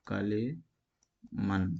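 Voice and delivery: کلیمن stretched very slowly, syllable by syllable; slow sounding out. Shown as a demonstration. Teacher spelling the word out for students, very slow. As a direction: drag out کلیمن so each sound is heard. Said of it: "جیسے کہ".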